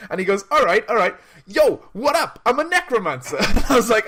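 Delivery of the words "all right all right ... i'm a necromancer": high, loud hype rapper. Retold or imitated. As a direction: imitate a villain turned cheesy rapper.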